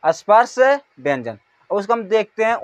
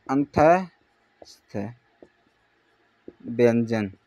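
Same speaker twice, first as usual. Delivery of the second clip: slow demo; 9 semitones lower, 6 dB quieter, much slower than usual. Shown as a demonstration. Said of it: "जैसे ही नाम से पता चल रहा है".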